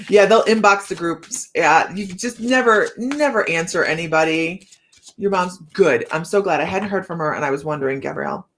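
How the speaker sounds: ordinary talk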